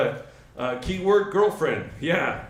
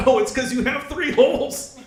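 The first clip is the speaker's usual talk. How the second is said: higher, exclaimed punchline; mock sudden realization; 7 semitones higher, 4 dB louder than usual. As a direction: blurt it like a comic eureka.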